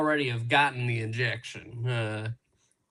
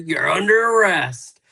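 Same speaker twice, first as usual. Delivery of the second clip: louder, higher mock cop line; hammed-up police declaration; mock arrest announcement; 5 semitones higher, 7 dB louder than usual. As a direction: announce the arrest with playful force. Acting the cop's arrest line.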